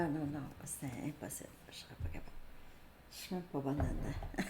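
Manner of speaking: whispered refusal to herself; shy, whispered backing out; refusing to attempt the accent, whispered; embarrassed whisper. Real, not acted.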